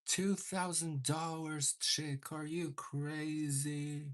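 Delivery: ordinary delivery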